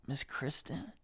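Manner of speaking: soft, hesitant imitated question; friend's timid question. Friend answering the door to a mom, imitated soft surprise. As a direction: ask it quietly, like he opened the door to trouble. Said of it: "he's like".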